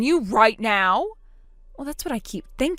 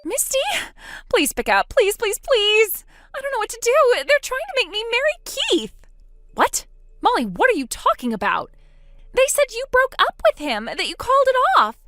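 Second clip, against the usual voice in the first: pitched well higher, pleading and frightened; a trapped sister begging for help on the phone; panicked younger sister; 12 semitones higher than usual. Acted. Distressed sister voiced by the narrator.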